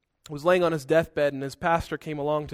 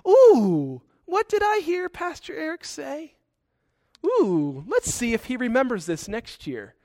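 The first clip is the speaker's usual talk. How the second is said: high, sneering devilish voice; sly Satan; 6 semitones higher than usual. Retold or imitated. Pastor voicing Satan plotting against him, sly. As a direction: gloat like a scheming devil. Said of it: "and he says".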